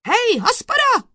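high, loud summons; judge's piercing call; shouting. A narrator's reading. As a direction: holler for the witness. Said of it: "křikl soudce".

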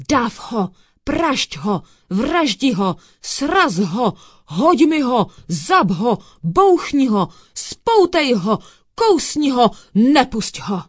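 high, loud chanted volley of commands; roaring. A narrator's reading. Dog below urges the cat on, shouting wildly. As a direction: egg someone on with barked orders. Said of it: "řval dole Bufino".

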